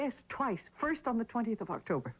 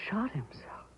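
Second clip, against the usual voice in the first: breathless whisper of disbelief; shocked whisper; 2 semitones higher, 3 dB quieter than usual. Acted. Widow hearing the lawyer's news, in a shocked whisper. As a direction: barely voice it, stunned.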